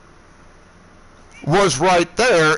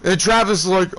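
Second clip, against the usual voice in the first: ordinary talk, nothing marked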